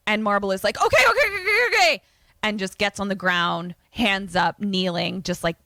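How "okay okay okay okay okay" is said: high, loud, frantic mock surrender; hammed-up terrified pleading; panicked begging. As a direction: blurt it like you're begging for your life. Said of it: "begging for his life".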